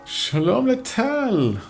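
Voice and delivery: drawn-out call. Real, not acted. Calling out a greeting.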